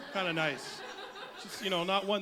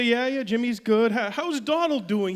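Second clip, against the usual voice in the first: loud, raised-pitch small talk; chatty neighbor; 5 semitones higher, 10 dB louder than usual. Acted. Friend greeting Donald's mother at the store, loud and chatty. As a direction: chirp the question loudly.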